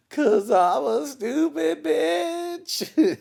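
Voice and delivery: very high, slow, drawn-out whine; whiny self-mockery. Answering his wife why he fucked up, high drawn-out whine. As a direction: stretch it out like a scolded child. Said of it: "I'll be like".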